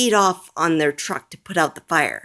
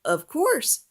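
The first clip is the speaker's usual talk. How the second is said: higher, helpful chief voice; friendly fire chief; 11 semitones higher than usual. A narrator's reading. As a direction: answer like a kind chief. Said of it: "says the chief".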